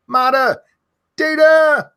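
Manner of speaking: high, loud shout of the name; screaming a name. Real, not acted. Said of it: "these people that I'm yelling".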